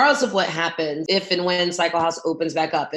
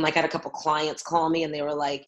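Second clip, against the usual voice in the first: ordinary delivery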